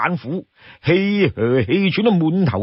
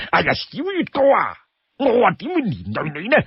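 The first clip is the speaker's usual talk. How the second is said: high, whining plea with stretched endings; pleading Li Kui; 3 semitones higher than usual. Acted. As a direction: beg and cajole your brother. Li Kui begs to come along.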